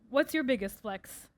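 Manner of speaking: normal talking voice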